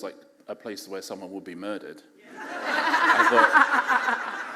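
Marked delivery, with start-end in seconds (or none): 2.4-4.6 laughing. Real, not acted. Laughs while telling.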